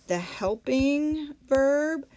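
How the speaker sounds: verb drawn out slowly for emphasis; emphasizing helping verb. Shown as a demonstration. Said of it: "teacher stressing key term".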